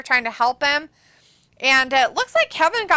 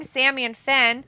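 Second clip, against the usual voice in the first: ordinary delivery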